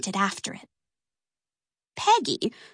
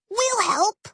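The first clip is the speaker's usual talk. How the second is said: high eager offer; eager exclaim; 11 semitones higher than usual. Acted. Peggy volunteering to the twins, high eager exclaim. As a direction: blurt it out, unable to hold it in. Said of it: "she exclaimed".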